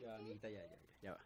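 very soft, tender hushing. Real, not acted. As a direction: settle the little one down gently. Calming her crying baby. (susurro tierno para calmar a la niña)

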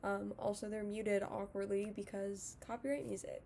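lip held open; talking with finger in mouth; finger pulling lip. Real, not acted. Seen 3: Finger hooks lower lip corner while speaking, showing braces throughout.

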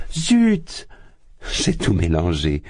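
hammed-up slip; mock dismay. A narrator's reading. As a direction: Porphyri feigning he confused the dates, loud. Porphyri feigned confusion.

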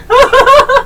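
sudden squealing laugh; laughing hard at a teammate's joke; high burst of laughter. Real, not acted. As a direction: break into a high cackle of laughter.

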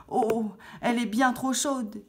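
put-on a little girl's dismayed "oh"; dismayed exclamation. A narrator's reading. Goldilocks tastes too-hot soup. (exclamation contrariée, plus aiguë)

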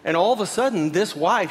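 usual speaking voice

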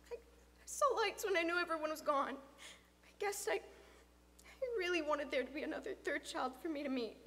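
lightly voiced halting boy; a hint of a timid, stammering boy; nervous boy. Boy confessing to friend's father, mildly nervous. Figure of Luke explaining.

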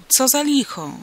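loud, high call; startled holler at something unseen. A narrator's reading. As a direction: shout down into the pit. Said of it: "zawołał".

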